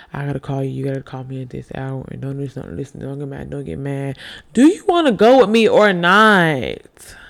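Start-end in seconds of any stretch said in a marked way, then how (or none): 4.5-7.2 loud, slow ultimatum; demanding shout. Acted. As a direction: shout the question out slowly.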